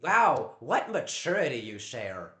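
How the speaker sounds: loud, scornful mock praise; sneering at the housemate's immaturity; sarcastic. Real, not acted. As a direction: throw it back at him with contempt.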